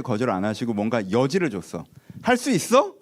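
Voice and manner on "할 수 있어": 높이 올려 솔깃하게 묻기; 여지를 주는 예수님의 질문 흉내; 기대에 찬 질문. Retold or imitated. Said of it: "라고 했다면". (높고 솔깃한 물음)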